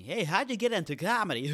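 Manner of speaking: high, loud dopey voice with a laugh; mock the listener in a squeaky high voice; impression of listener. Comic mocks a listener asking how he started, high and loud. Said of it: "That's my impression of you".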